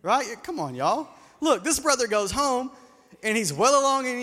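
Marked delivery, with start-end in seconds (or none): none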